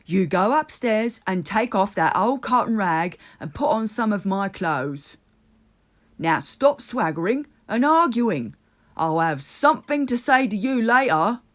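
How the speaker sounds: grave Rat. A narrator's reading. Rat ordering Toad to change, stern and grave. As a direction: lay down the law, gravely. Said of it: "said Rat gravely".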